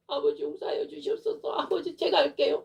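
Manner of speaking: high, urgent repentant plea; pleading prayer. Retold or imitated. Preacher answering the Father's longing, urgent pleading prayer.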